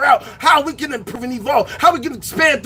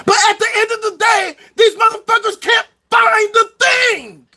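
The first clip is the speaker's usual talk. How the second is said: angry rant; 8 semitones higher than usual. Real, not acted. Ranting at people who can't find the thing, furious shout. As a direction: yell it out in disgust.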